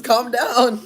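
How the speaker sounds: loud, high yell; scolding shout. Real, not acted. Calling out at someone making noises.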